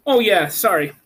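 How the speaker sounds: usual speaking voice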